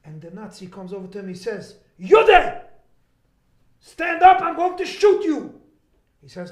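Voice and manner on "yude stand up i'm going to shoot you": barked threat, much louder and higher; furious shout. Retold or imitated. Rabbi voicing the Nazi guard's threat, a furious shout. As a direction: yell it down at a man on the ground.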